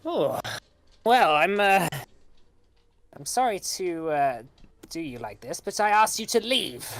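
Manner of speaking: high, loud hag voice; hammy old hag pleading. Acted. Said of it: "the hag says".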